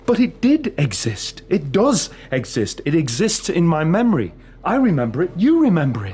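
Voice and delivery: cling to the memory with everything; desperate insistence. Acted. Prisoner pleading about the photo.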